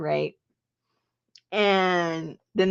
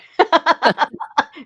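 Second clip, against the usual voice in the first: real high burst of laughter; bright loud laugh; 10 semitones higher, 7 dB louder than usual. Real, not acted. Laughing about son's birth.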